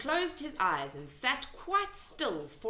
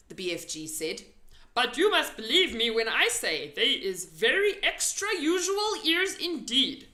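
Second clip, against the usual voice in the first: louder, proud giant; BFG voice; 3 semitones higher, 7 dB louder than usual. A narrator's reading. The giant insisting to Sophie his ears are special. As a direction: stand up for your big ears.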